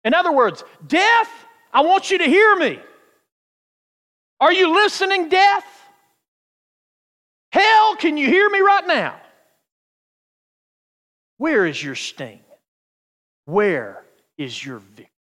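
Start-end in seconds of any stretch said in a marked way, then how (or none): 0.9-9.2 high, loud taunting shout; chiding and mocking. Preacher shouts at death and hell, chiding them. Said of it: "they're almost chiding it and mocking it". 11.4-15.1 lower, measured taunt after a shout; mocking taunt. Preacher questions a beaten death, mocking it. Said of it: "they're almost chiding it and mocking it".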